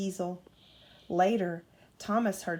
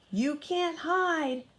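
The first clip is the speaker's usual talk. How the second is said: high, slow mock shout; storybook villain calling out, high and slow; 8 semitones higher, slower than usual. A narrator's reading. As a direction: draw the threat out high and slow. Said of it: "shouted Diesel".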